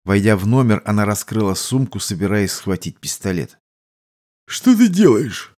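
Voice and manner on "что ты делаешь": slow, drowsy question; just woken, still half asleep; sleepy voice. Acted. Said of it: "сонным голосом спросил Кальбери".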